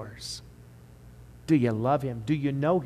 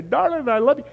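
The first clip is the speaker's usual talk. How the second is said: high, tender, rising love; the wife replying lovingly, high and sweet; wife's reply; 11 semitones higher, 6 dB louder than usual. Retold or imitated. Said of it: "She'll say".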